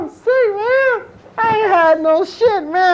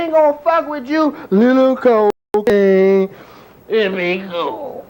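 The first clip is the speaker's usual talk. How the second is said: imitating the dealer; 6 semitones lower than usual. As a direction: coax him like a sly pusher. Quoting the dealer's pitch.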